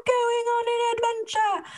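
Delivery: high, eager little hobbit cry; excited Bilbo. Retold or imitated. Young Bilbo dashing off to his first adventure, eager. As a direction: squeal it like a thrilled young hobbit. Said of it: "he's like".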